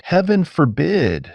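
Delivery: pious, half-joking aside; piously. A narrator's reading. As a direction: say it like a mock prayer. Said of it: "he replied piously".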